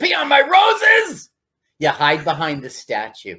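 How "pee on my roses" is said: high furious yell at intruders; angry shout. Pretend. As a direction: scream it like a mad gardener. Angry gardener yelling.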